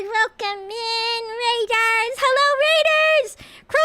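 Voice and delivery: high, drawn-out greeting; excited welcome. Real, not acted. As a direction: call out a big welcome. Welcoming raid.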